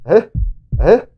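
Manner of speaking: normal talking voice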